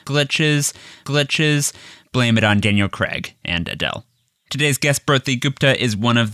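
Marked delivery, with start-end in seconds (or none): 0.1-1.7 glitchy stuttering repeat; audio glitch. Pretend. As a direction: skip like a glitching recording. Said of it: "if there's any weird audio glitches".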